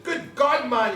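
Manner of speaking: high disgusted exclamation; a startled old man's grimace; mock disgust. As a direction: spit it out like foul taste. Crofter tasting whisky, exclaiming.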